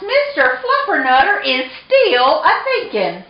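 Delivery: mama cat's answer. A narrator's reading. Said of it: "answered Mama Junebug Jones".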